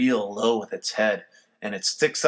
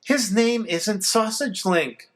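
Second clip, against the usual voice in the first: grumbling; 8 semitones higher, slower than usual. A narrator's reading. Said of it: "Mom grumbles".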